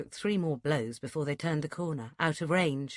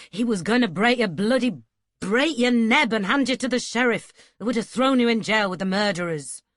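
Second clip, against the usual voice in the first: louder, higher angry outburst; voice rising in anger; 4 semitones higher, 8 dB louder than usual. A narrator's reading. Duncan raising his voice at the boy about the sheriff. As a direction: let the fear come out as anger. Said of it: "His voice rose".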